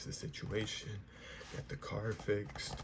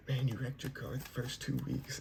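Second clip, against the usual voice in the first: teasing; 5 semitones higher than usual. Retold or imitated. Imitating a friend's teasing joke.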